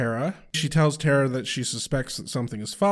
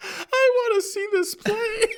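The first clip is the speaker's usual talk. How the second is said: very high, squealed through laughter; helpless laughter pushing the words sky-high; laughing squeal; 4 dB louder than usual. Real, not acted. Cohost delighted by purposely terrible theater, squealing with laughter. Laughing over the absurd scene.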